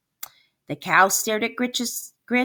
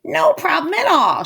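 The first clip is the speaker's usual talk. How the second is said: sly witch; 8 semitones higher, 5 dB louder than usual. Acted.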